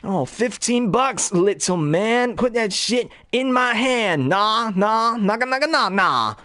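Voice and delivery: high goofy dealer voice chanting nah nah; Jay impression. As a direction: drawl it out like Jay hustling a dime bag. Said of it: "he goes".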